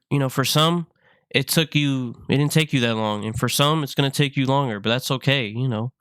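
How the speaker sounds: ordinary talk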